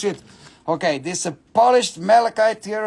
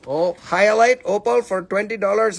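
ordinary talk

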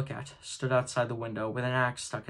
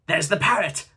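higher, louder cry of delight; excited cry; 5 semitones higher, 7 dB louder than usual. A narrator's reading. Scrooge spotting the parrot, excited cry. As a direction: call it out with joy. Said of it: "cried Scrooge".

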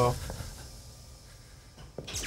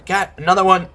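startled; 7 semitones higher, 6 dB louder than usual. Real, not acted. Startled by a scare.

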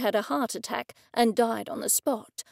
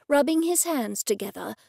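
ordinary delivery

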